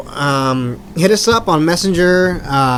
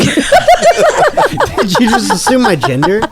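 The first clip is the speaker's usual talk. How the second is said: offended mock voice; 7 semitones higher, 3 dB louder, much slower than usual.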